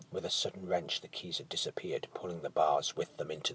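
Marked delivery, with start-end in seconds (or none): none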